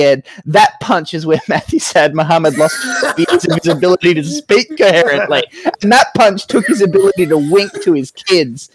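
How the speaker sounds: laughing through the words, high and loud; can barely get it out for laughing. Real, not acted. Laughing while describing Ed.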